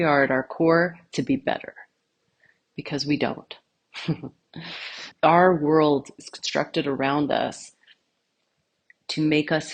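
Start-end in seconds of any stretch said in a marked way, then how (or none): 4.5-5.2 short, quiet laugh; soft chuckle. Real, not acted. Speaker amused by her own aside, soft chuckle. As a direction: chuckle briefly under your breath.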